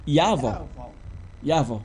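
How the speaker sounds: Yavo with an open ah in the first syllable; right pronunciation. Shown as a demonstration. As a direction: demonstrate Yavo as YAH-vo. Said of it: "So the right pronunciation".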